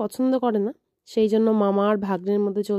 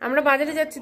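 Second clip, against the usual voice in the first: usual speaking voice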